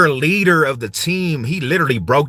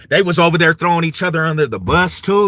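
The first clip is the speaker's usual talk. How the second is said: ordinary talk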